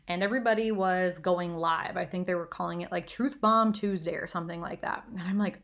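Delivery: normal talking voice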